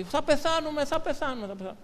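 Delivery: high, panicky repeated wail that fades at the end; panicked fearful cry. Retold or imitated. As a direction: mimic a frightened man sure he will die. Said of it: "λέει". (φοβισμένη, πανικόβλητη κραυγή)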